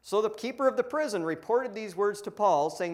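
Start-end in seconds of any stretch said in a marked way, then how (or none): none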